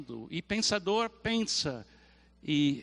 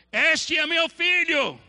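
slow, loud, booming and higher; voice of God; 9 semitones higher, 6 dB louder, slower than usual. Acted. Preacher voicing God to the disciples, booming and slow. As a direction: proclaim it slowly from the heavens. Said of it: "agora eu vou usar a voz de Deus".

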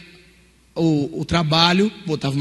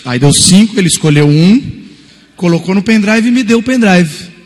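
loud build; 10 dB louder than usual. Real, not acted. Preacher builds indignation.

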